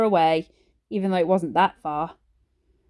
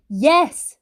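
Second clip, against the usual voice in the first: loud high shouted yes; 6 semitones higher, 6 dB louder than usual. A narrator's reading. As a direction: insist with a yell. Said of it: "shouted Ben".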